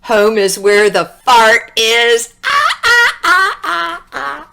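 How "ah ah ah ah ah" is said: high ah calls. Acted. Fart's theme, sneaking.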